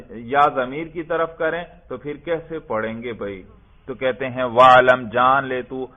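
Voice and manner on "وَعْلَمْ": loud formal recitation of the Arabic; reading the text aloud. Shown as a demonstration. Teacher loudly reciting the Arabic text to his class. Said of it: "teacher reads the Arabic text aloud".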